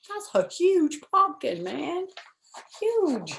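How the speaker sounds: high, excited aside about the pumpkin; wide-eyed wonder at a huge pumpkin; amazed aside. Real, not acted. Reader's aside to children.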